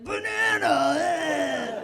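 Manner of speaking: loud, high caricature dude yell; dude impression. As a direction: bellow it as a dumb guy. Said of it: "If I'm like".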